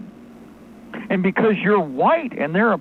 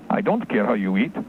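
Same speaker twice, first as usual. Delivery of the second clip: much lower, dismissive official; cold clerk; 10 semitones lower than usual. Pretend. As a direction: shut the caller down. Clerk brushes off the caller.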